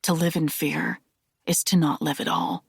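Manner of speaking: ordinary delivery, nothing marked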